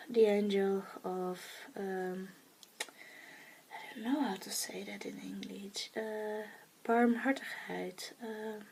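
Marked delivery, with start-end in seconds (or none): 2.8-5.9 whisper. Real, not acted. Searching for an English word.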